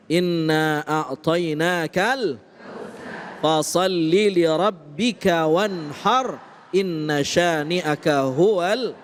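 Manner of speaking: slow chanted Quran recitation. Acted. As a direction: recite the verse slowly, drawn out. Ustadz recites Quran verse.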